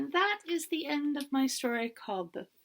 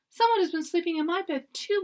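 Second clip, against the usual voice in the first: higher, louder mock cry; crying-out Mama Bear; 7 semitones higher, 6 dB louder than usual. A narrator's reading. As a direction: call out like an upset mother bear. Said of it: "Mama Bear cried".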